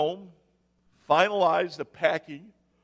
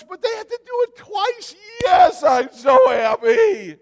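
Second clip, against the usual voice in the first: high, loud mock-happy yell, slowing at the end; sarcastic shout; 14 semitones higher, 12 dB louder than usual. Pastor mocking joy about a second kidney stone procedure, loud sarcastic shout. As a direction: bellow the fake happiness.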